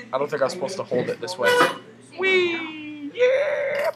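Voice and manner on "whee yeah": very high, loud whooping; excited squeal. Real, not acted. Player flying on a rope swing, excited squeal. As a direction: shriek with glee on a swing.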